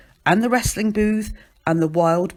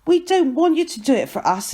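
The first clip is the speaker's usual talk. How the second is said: high-pitched, moderately raised protest; contained yell of alarm; 8 semitones higher, 3 dB louder than usual. A narrator's reading. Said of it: "yells Ernie".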